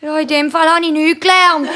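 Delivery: hohe Mädchenstimme; Kinderstimme. A narrator's reading. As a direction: antworte hoch wie ein Schulkind. Kind Nina antwortet der Mami. (hochi Chinderstimm)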